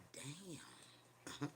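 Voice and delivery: breathless whispered damn; awed whisper. Retold or imitated. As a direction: let it slip out under your breath. Stunned by the spirit in kitchen.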